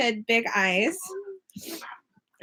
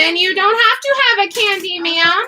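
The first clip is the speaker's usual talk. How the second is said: stern mom scolding, pitched up; mean mommy voice; 6 semitones higher than usual. Real, not acted. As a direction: lay down the law like a strict mom. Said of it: "That's my mean mommy voice".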